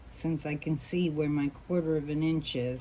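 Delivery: ordinary talk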